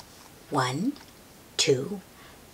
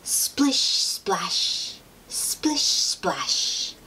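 splashing play sounds; 3 semitones higher, much slower than usual. Acted. Puddle jumping sound words.

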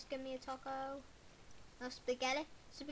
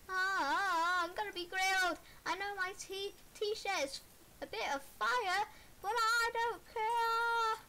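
high wailing, voice breaking; panicked crying yell; 5 semitones higher, 6 dB louder than usual. Pretend. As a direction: cry out in pretend agony. Character burning, crying out.

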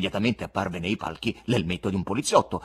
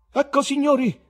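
higher, louder forced compere voice; Bengalski booming; 11 semitones higher, 3 dB louder than usual. A narrator's reading. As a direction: project to calm the hall. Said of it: "con la voce più sonora".

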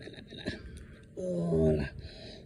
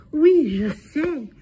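higher, slow, drawn-out sing-song; sing-song French; 8 semitones higher, 5 dB louder, much slower than usual. Real, not acted. Sitter teasing the cat in French, slow and sing-song. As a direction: stretch "Oui, je sais" out high and slow.

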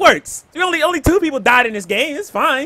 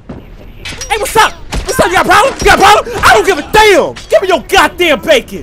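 aggressive taunt; 6 semitones higher, 7 dB louder than usual. Real, not acted. Trash talking enemy in game.

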